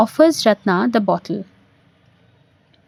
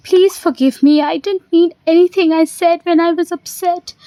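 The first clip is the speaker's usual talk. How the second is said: higher-pitched, mildly pleading voice; pleading apology; 6 semitones higher than usual. Acted. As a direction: beg forgiveness with pitch raised. Ratna begs forgiveness after quarrel.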